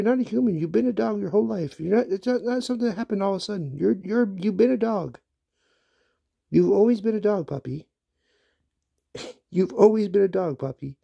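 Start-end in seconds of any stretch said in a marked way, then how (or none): none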